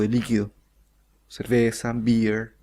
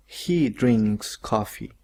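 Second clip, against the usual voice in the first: slow model sentence; much slower than usual. Shown as a demonstration.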